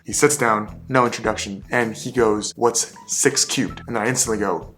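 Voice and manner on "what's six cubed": slow, deliberate, testing each word; slow stern question. Retold or imitated. Stern interviewer slowly testing the new candidate. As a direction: quiz the candidate slowly like a bad cop.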